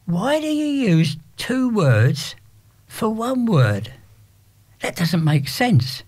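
put-on a bemused young girl questioning, drawn out; puzzled teen girl. Teen girl questioning cockney slang, high slow puzzled voice.

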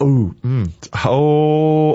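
ordinary talk, nothing marked

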